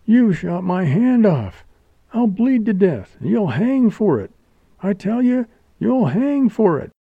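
high-pitched, anguished accusation; indignant cry. A narrator's reading. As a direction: threaten him through pain and outrage. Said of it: "cried Geraldine".

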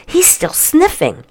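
excited, high-pitched discovery; burst of excitement. Acted. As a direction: point it out, thrilled. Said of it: "with a burst of excitement".

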